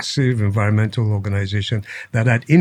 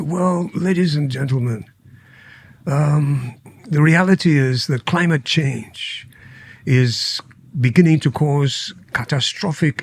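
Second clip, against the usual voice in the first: imitated royal British speech-making, pitched up; Prince Charles's accent; 3 semitones higher than usual. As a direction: address world leaders in put-on Queen's English, measured and deliberate. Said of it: "And he said to them".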